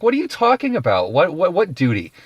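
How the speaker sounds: high, exasperated pleading; incredulous Pilate. Retold or imitated. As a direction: plead with the crowd in disbelief. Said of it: "He was like".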